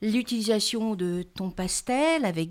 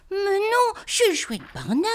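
protesting; 11 semitones higher than usual. Acted. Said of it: "proteste Mitch".

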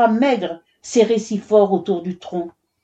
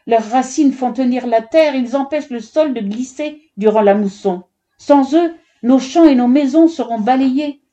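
higher, urgent appeal; pleading; 6 semitones higher, 4 dB louder than usual. A narrator's reading. Mother begging the foreman to spare the trees, pleading. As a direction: plead your case with rising insistence. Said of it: "supplia la mère d'Amrita".

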